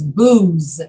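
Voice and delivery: booze with a long, buzzing Z ending; voiced Z. Shown as a demonstration. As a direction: say booze, voice humming through the final Z. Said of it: "you have to use your voice to say the Z".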